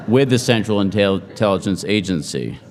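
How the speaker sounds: usual speaking voice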